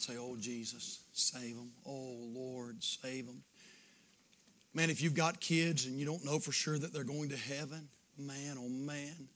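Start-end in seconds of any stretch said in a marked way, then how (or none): 0.2-3.4 much softer, pleading prayer voice; soft imagined altar prayer; hushed prayer. Acted. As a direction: pray quietly and earnestly.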